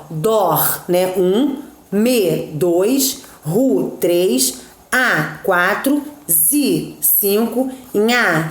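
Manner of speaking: each poetic syllable sounded out on its own, then its count number spoken after it; slow scansion, tapping out one syllable at a time. Shown as a demonstration. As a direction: split the verse into metric syllables, counting each aloud. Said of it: "Então, observem aí".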